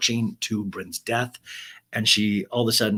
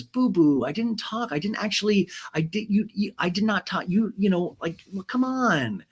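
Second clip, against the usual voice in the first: flustered denial; 9 semitones higher than usual. Retold or imitated.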